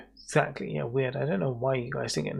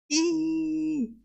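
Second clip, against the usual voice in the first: excited squeal. Real, not acted. Squealing at a raid.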